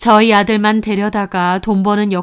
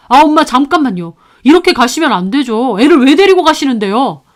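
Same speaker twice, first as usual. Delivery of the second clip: louder, high anxious pleading; pleading son; 5 semitones higher, 8 dB louder than usual. Acted. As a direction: beg her to wait. Son tries to stop her.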